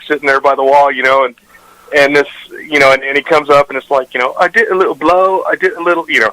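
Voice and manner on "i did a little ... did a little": mock British accent, pitched higher, sleazy. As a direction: read it like a sleazy Brit confessing. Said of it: "with a British accent".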